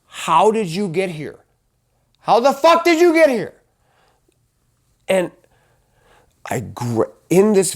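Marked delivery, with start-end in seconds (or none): 0.1-1.4 higher-pitched demand, held back in volume; first, restrained stage of screaming at yourself; acting out a reproach without full shouting; retold scream at oneself. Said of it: "wanting to scream at myself". 2.2-3.5 very high, fast strained yell; acted-out outburst, pitch shooting way up; retold scream. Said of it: "wanting to scream at myself".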